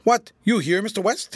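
angry, loud outburst. Acted. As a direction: snap it at him in anger. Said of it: "his temper was at boiling point".

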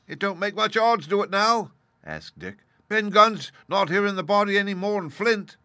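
high, anxious sailor; nervous pirate voice. A narrator's reading. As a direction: fret over the ghost. Dick doubtful, voiced.

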